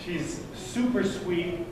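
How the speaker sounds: ordinary talk, nothing marked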